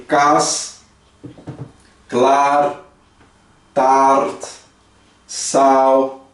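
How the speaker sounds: "kas, klaar, taart, sal" each with a long open A; one word at a time, A held open. Shown as a demonstration. Teacher listing double-A words for repetition, long open A in each. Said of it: "no holandês o double A".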